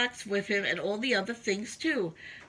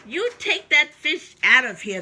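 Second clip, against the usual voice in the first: loud, lightly voiced angry crowd; a hint of an annoyed shout; yelled; 3 semitones higher, 7 dB louder than usual. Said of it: "they yelled".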